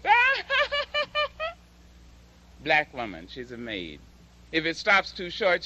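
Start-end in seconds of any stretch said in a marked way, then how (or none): 0.1-1.5 shrill high "Ah!" then forced laugh; high startled cry and laugh. Retold or imitated. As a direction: mimic the maid's nervous laugh. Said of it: "she goes".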